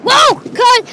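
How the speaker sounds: high, loud burst of shock; startled, jumping up in pitch and volume; startled cry. Real, not acted. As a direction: cry out in real alarm. Startled as car moves.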